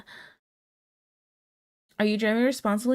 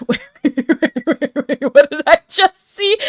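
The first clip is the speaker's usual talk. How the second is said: louder, laughing disbelief; surprised laughing; 6 semitones higher, 9 dB louder than usual. Real, not acted. Streamer spotting a wild chat message, loud laughing disbelief. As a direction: stop and laugh, wait what.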